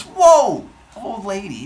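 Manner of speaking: loud, high mock "whoa"; acted astonished outburst; shocked disbelief. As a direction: react hugely, as if stunned. Acted shocked reply to Hera.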